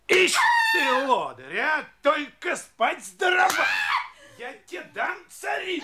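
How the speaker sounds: резкий бранчливый крик; орёт, отчитывая лентяйку; shrill nagging grandma. Acted. Сапожник бранит и порет жену. (сапожник бранит жену криком)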